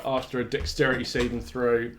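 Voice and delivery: usual speaking voice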